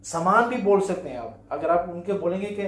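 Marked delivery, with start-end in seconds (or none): none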